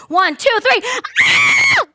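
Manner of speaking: high shouted count bursting into a scream; loud countdown exploding into a shriek; building into scream. Retold or imitated. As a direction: count off loudly, then let a scream rip. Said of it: "we're gonna scream".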